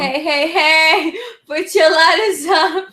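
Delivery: loud, high, slowed cheer through a laugh; Laganja impression. Mocking Laganja's roast catchphrase, loud laughing cheer. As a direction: hype it up like a drag queen yelling to the crowd. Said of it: "Ganja's in the house".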